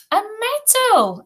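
very high, stretched-out exclamation; gloating over a prize; awestruck greed. A narrator's reading. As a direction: marvel slowly in a high voice. Bully gazes madly at medal.

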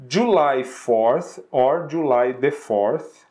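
slow, careful English date; slow model date. Shown as a demonstration. Teacher modeling a date for students, slow and careful. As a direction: say it slowly for students to copy. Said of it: "you can say".

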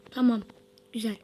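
ordinary talk, nothing marked

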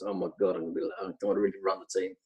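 low, fast, soft mutter of dread; dismayed inner thought. Retold or imitated. New coach hearing the boss is leaving, muttering low and fast in dread. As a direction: mumble it low and quick to yourself, horrified. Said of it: "That was my first thoughts".

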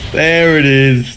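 mock catchphrase. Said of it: "The Natho classic".

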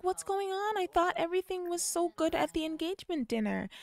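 high, motherly mom voice; voicing a worried mother. Narrator voicing the mom questioning her son, high.

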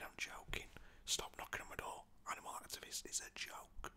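usual speaking voice

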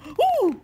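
high, loud startled ooh; ghostly ooh. Acted. Ghost is startled by the princess, high and loud. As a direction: yelp like a frightened ghost. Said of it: "said the little ghost".

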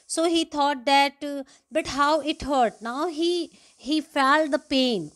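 dramatic story reading. Acted. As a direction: read it out with full force. Reads Hari's realisation aloud.

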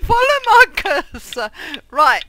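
high, loud urgent directions; frantic shouting. Real, not acted. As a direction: yell it fast and panicked.

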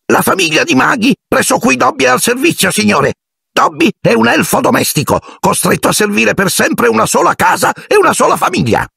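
a servant too anxious to begin; fretful house-elf. A narrator's reading. Dobby explains his servitude.

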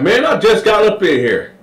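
high innocent tone; Sweet Lo. Retold or imitated. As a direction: play innocent. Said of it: "Sweet Lo was like".

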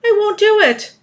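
high, loud protest; loud refusal. A narrator's reading. Earthworm refusing the plan, protesting loudly. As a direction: dig in and refuse outright.

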